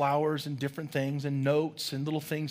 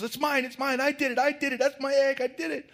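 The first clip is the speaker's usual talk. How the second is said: put-on high, pleading child insisting; a boy desperate to be believed, high; upset child; 11 semitones higher, 3 dB louder than usual. Philip upset defending himself.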